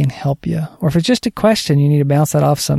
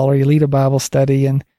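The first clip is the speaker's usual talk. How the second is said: ordinary talk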